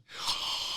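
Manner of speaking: big breathy intake; sharp overawed gasp; sudden gasp. Retold or imitated. Acts out being overwhelmed.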